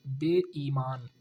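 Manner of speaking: बेईमान said slowly, each syllable clear; slow model word. Shown as a demonstration. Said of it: "बेईमान होता है". (बेईमान धीरे-धीरे)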